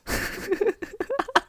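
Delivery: high giggling burst; high laugh. Real, not acted. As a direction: giggle at your own joke. Laughing at own gossip bit.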